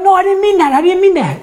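put-on laughing, high-pitched little girl's retraction; daughter backpedaling. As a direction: play the flustered daughter taking it back. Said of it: "she's like".

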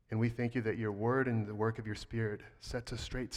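soft, low closing prayer. Real, not acted. Pastor praying softly over the church before the sermon. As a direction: pray quietly over the congregation.

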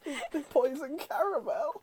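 mock witch. Repeating the witch line.

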